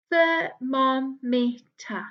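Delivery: "Thermometer" drawn out slowly, every syllable clear; teacher stretching out "Thermometer"; slow syllable model. Shown as a demonstration. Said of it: "My turn".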